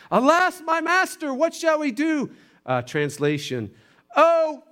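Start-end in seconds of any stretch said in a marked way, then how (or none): 0.1-2.3 high, loud, hammed-up wail; panicked yell. Servant to master at sight of an army, yelling. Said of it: "and he yells". 4.2-4.6 loud, high theatrical cry; mock panicked yell. Servant translated for the congregation, yelling. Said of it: "and he yells".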